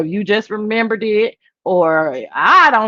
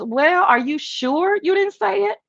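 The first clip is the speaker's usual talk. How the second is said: imitating Trish; 9 semitones higher than usual. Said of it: "Trish goes".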